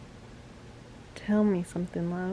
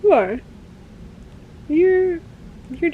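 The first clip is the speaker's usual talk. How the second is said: loud, high, accusing outburst; angry accusation; 9 semitones higher, 6 dB louder than usual. Acted. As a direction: throw it at her like an accusation. Acting out Warner's accusation.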